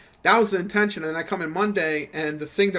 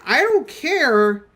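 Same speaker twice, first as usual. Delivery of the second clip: louder, higher, slow and exasperated; exasperated yell; 8 semitones higher, 6 dB louder, much slower than usual. Retold or imitated. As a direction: stretch it out in disbelief. Venting at coworkers, exasperated.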